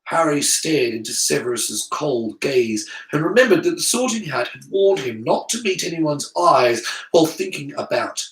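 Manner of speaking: usual speaking voice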